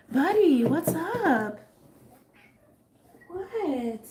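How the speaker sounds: high, loud sweet voice for the pet; pet talk. Real, not acted. Talking to her restless pet, high pet voice. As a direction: coo at the pet.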